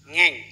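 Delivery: put-on loud, short toddler's "ngeng"; imitated car noise. Father imitating his child asking for the car, car noise. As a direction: mimic a child's engine sound, loudly. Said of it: "Ngeng itu artinya mobil".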